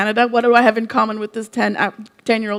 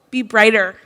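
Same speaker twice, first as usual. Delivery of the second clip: usual speaking voice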